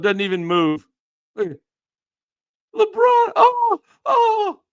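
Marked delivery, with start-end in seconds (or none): 2.7-4.6 much higher, loud fake cry; mock wail. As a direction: wail like a faker falling. Mocking LeBron falling.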